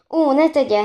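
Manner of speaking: high, loud plea to stop; hasty interjection. A narrator's reading. Countess stops uncle phoning the police, urgent interjection. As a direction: cut in to hold him back. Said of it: "szólt közbe Szépteleki grófnő".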